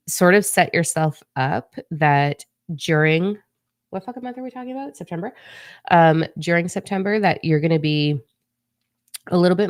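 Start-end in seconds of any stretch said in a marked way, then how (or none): 3.9-5.3 quick aside. Real, not acted. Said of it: "asking herself the month".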